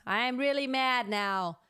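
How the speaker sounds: slow, loud angry demo; mad. Shown as a demonstration. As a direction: open the throat and get mad. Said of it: "it's very open".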